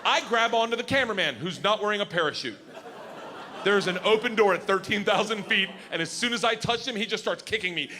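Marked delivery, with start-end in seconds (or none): none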